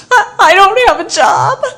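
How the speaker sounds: mock crying. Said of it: "she was crying".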